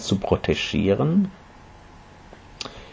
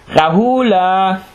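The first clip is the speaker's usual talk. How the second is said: high drawn-out call across the house; 10 semitones higher, slower than usual. Acted. Mother calling her son. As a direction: call the boy's name sweetly. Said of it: "rief sie".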